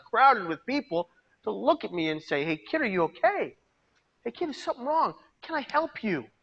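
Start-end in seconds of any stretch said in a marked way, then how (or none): 2.4-6.3 much higher, gentle concerned asking; caring stranger. Pretend. Imagined bus passenger asking the crying kid, high and caring. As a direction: reach out kindly to a hurting kid.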